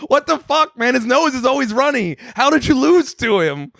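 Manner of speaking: high-pitched, comic villain's disbelief; mock exasperated outrage. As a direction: rant in mock disbelief at a failed henchman. Acted outraged movie villain, parody.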